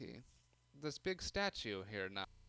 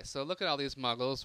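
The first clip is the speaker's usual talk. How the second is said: ordinary talk, nothing marked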